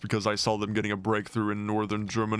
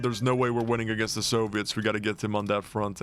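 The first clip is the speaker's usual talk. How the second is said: usual speaking voice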